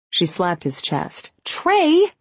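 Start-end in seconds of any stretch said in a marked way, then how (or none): 1.4-2.1 high shocked squeal of his name; scandalized yelp. A narrator's reading. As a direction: yelp it out, half outraged. She slaps his chest, scandalized.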